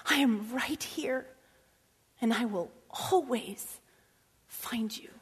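slow hushed comfort from God. Retold or imitated. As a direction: reassure gently, like a father. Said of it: "And God is saying".

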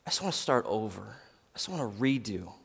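soft, understated imitation of crying; hushed retelling of someone crying; retold tearful plea. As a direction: quietly voice a worn-out, tearful plea. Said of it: "she's just crying and crying to me".

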